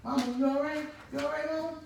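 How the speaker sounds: high, loud, alarmed call; retold alarm. Son to mother fallen on the couch, alarmed high call. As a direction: call out to Mama in acted panic. Mother fell clutching her heart.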